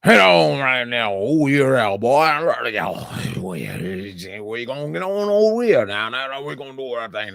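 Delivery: thick Louisiana Creole accent, hammed up; over-the-top Cajun Creole accent; heavy Creole accent. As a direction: lay the Creole on thick like a bayou coach. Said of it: "the water boy assistant coach Creole".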